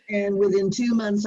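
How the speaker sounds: ordinary talk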